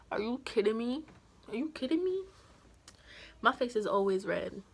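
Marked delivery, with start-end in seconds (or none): 0.1-2.2 incredulous question, climbing higher on the repeat; re-enacted disbelief. Girlfriend confronting boyfriend who denied the letter, mounting incredulity. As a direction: build the disbelief with a rising pitch.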